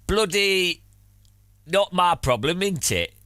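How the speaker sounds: hammed-up Northern English miner; Northern English coal miner chatting to chat; Northern English accent. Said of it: "Don't know what accent it is".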